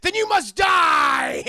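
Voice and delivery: slow, very loud, high battle scream; furious shout. Acted. Jade turning on Liu Kang, slow furious scream. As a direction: scream the threat out. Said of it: "She goes from, from fucking to".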